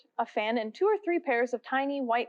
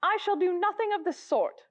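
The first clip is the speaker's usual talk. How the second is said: indignant mouse; 7 semitones higher than usual. A narrator's reading. Mouse refusing Alice.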